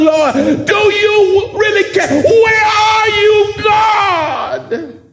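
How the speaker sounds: anguished cry to God. Acted. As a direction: rise into a desperate shout at heaven. Preacher voices sufferer crying to God.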